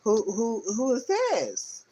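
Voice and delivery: high, drawn-out questioning; startled card figures. Acted. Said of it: "They're like".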